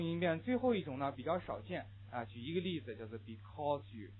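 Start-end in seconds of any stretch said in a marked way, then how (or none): 3.2-4.1 slow "because you" as becau-zhu; drawn-out model "because you" for repetition; read-after-me linking. Shown as a demonstration. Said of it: "now please read after me".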